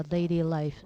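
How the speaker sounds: usual speaking voice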